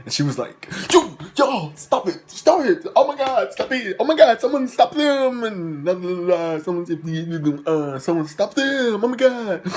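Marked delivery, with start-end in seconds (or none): 0.9-5.4 high, loud panicked teacher impression; mock screaming. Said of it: "And she was, like, screaming". 5.4-6.6 high-pitched mocking filler; mocking babble. Retold panicked yelling, trails into filler. 6.6-9.7 high-pitched frantic plea, imitated; mock screaming. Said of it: "And she was, like, screaming".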